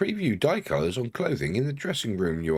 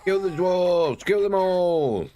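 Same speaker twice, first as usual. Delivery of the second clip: goblin war cry; 9 semitones higher, 4 dB louder, slower than usual. Acted. Goblin battle cry in game.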